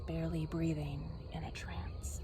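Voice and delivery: hushed, breathless whisper. Acted. Narrator hushing the moment Alex enters a trance, whispered.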